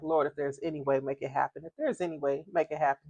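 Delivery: soft murmured prayer; quiet plea to God; silent prayer. Retold or imitated. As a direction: pray under your breath. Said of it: "I'm just in my mind like".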